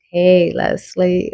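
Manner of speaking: a very slow, drawn-out greeting; really slow. Retold or imitated. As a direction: stretch out every word of hey, Leslie. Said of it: "really slow and like".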